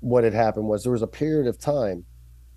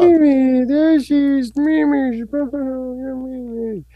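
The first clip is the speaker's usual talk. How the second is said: very high cooing pet voice; 6 dB louder, slower than usual. Real, not acted. Talking to the dog again.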